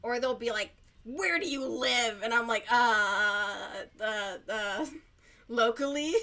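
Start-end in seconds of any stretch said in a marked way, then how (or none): 1.0-2.2 loud mock demand; imitated nosy caller. Caller asking agent where they live, loud mock demand. 2.7-6.1 loud, drawn-out hesitation into a questioning answer; flustered stalling. Retold or imitated. Agent dodging caller's question about home, mock-flustered stalling.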